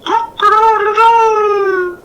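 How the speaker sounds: high, slow crowing cock-a-doodle-doo; loud, drawn-out rooster crow. A narrator's reading. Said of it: "He made his call to the hens".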